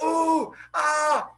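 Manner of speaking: high, drawn-out oohs and ahs; mock crowd reaction; shocked crowd. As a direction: imitate an onlooking crowd gasping. Voicing the shocked watching crowd.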